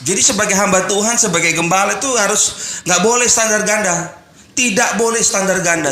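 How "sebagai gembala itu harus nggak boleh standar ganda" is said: ordinary delivery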